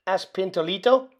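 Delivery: ordinary delivery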